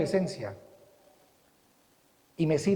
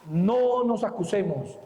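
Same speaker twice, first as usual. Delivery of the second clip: ordinary talk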